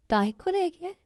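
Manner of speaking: incredulous rising echo; can't believe she was taken; shocked disbelief. Acted. Brother hears sister was taken, stunned disbelief.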